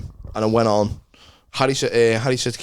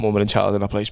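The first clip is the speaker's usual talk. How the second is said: normal talking voice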